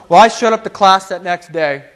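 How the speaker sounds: normal talking voice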